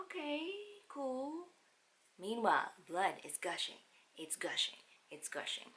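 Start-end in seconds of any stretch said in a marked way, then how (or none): 0.0-1.5 slow, drawn-out mock calm; sarcastic okay cool. Mother hearing about the bleed, slow mock calm. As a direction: play it dry and deadpan.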